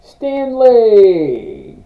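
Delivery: loud, high, drawn-out shout of a name; calling out. Real, not acted. Host greeting a viewer in the chat, calling out. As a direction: holler the name long, sliding down.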